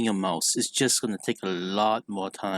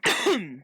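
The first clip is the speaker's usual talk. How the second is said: harsh real cough; coughing fit; 11 semitones higher than usual. Real, not acted. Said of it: "I'm coughing so much".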